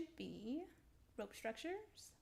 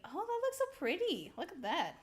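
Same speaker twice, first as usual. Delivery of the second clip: delighted; 8 semitones higher, 5 dB louder than usual. Real, not acted. Delighted by build result.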